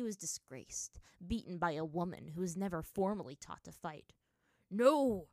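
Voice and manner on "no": loud, drawn cry of defeat; anguished yell. A narrator's reading. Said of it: "he yelled, anguished".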